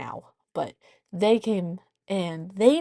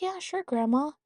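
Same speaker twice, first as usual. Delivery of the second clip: put-on child voice; 8 semitones higher than usual. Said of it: "And she's like".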